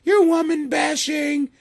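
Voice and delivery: high, slow mock complaint; mocking angry woman. Imitating women getting mad at him, high mock whine. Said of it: "ladies are gonna get mad".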